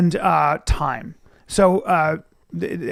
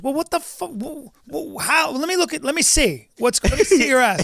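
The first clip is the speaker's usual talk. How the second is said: raised, higher exasperated dad; the doctor father demanding to look; 7 semitones higher than usual. Retold or imitated. The doctor father to his son, exasperated and higher. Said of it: "And he goes".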